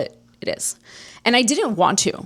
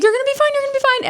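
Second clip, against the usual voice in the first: very high, fast imitation of a friend; frantic reassurance; 3 dB louder, much faster than usual. Friend calming her hysterical crying, frantic high-pitched mimicry. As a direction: squeal the comfort in a rapid rush.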